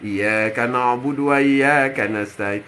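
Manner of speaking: low, drawn-out chant; slow Quranic recitation. Acted. Preacher reciting in prayer before God, slow chant. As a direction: intone the verse slowly, facing God.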